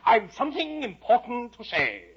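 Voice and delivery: slow, high, loud proclamation; calling after her. Acted. Storyteller as caterpillar summoning Alice back, loud and pompous. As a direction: announce it grandly as the caterpillar. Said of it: "caterpillar called after her".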